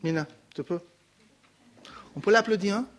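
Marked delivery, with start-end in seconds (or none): none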